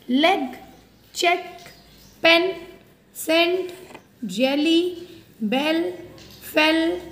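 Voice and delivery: quick word list. Shown as a demonstration. Teacher to pupils, reading short-vowel words quickly. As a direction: rattle off each word. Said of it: "quickly, बहुत quickly बोला जा रहा है".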